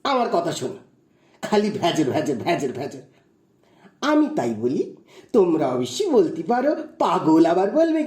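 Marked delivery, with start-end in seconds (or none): 1.4-3.0 mocking chatter.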